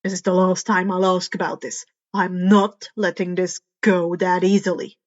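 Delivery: a tired hero who won't drop the subject; Eraser's stern voice. Acted. Eraser pressing Karma for answers, low and stern. Eraser speaking, lower and louder.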